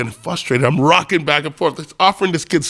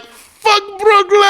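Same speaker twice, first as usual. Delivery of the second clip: high, drawn-out stage yell; long, loud imitated rallying cry; rally shout; 6 dB louder, slower than usual. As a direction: shout it like firing up a huge crowd. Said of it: "Masai goes on stage and he's like".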